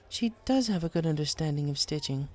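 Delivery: a hint of an elegant lady pony; Rarity voice. A narrator's reading. Rarity answers the bartender, lightly voiced.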